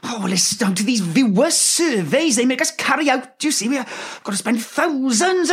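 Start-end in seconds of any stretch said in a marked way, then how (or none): none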